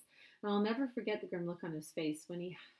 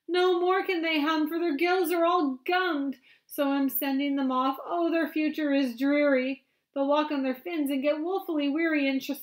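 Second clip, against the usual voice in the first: high, loud lament; mournful Lorax; 8 semitones higher, 13 dB louder than usual. Acted. Lorax laments the fish to the Once-ler, high and loud. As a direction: grieve for the hummingfish. Lorax voiced reading aloud.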